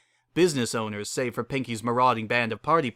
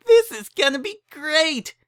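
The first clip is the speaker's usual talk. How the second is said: much higher, louder, slowed with delight; squealing high pony delight; gleeful Pinky; 7 dB louder, slower than usual. A narrator's reading. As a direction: savor it like a happy party pony. Pinky delighted amid raccoon chaos.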